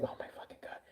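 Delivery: whispered disbelief, barely any voice; exasperated whisper. Retold or imitated. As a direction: whisper it as if all patience is gone. Retold despair while waiting.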